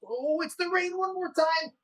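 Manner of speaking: high, soft oh of disappointment; mock dismay. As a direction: sigh it out, the rain again.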